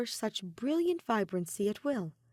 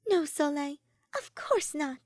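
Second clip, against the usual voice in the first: reassuring; 7 semitones higher, 3 dB louder than usual. A narrator's reading. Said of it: "Ophelia smiles reassuringly".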